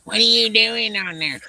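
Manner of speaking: high, slow, put-on nagging wife. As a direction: whine it like a wife calling over the headset. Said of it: "You did that one pretty good".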